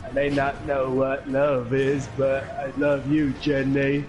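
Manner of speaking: slow, simple Southern drawl impression; Forrest Gump. Player quoting film love line, slow drawling impression. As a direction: draw the words out in a mock Southern voice. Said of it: "such a funny part in Forrest Gump".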